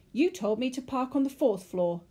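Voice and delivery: loud, high, outraged shout; man screaming in exasperation; screamed. A narrator's reading. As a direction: shout the accusation at your wife. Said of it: "the man screamed".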